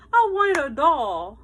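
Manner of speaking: high, loud, slow tearful plea; sobbed. A narrator's reading. Girl on Christmas morning telling father, tearful. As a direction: say it through mock tears. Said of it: "I sobbed".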